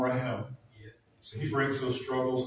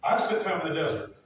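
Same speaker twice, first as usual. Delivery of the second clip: full-force preaching; 6 semitones higher, 4 dB louder than usual. Acted. Preacher rallying the congregation about desert times, louder and higher. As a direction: drive the point home from the pulpit.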